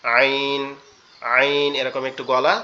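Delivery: আইন pressed from the throat, slowly drawn out; throaty ain. Shown as a demonstration. Teacher demonstrates the throaty আইন to students. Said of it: "চাপা আওয়াজে পড়তে হবে এটা".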